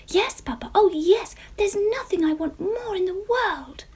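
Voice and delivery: high, loud eager pleading; eager child. A narrator's reading. Jemima answering papa, high and eager. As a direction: beam it up to papa.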